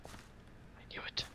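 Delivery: a secret whisper. Real, not acted. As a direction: hush it like a conspirator. Conspiratorial aside about moles.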